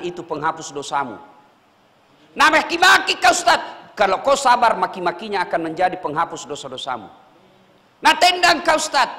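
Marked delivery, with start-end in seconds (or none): 2.4-3.6 loud, high mock cursing; hammed-up abuse at the preacher. Acting out cursing scenario. 8.0-9.1 loud, high mock threat; mock threat to kick. Acting out kicking scenario.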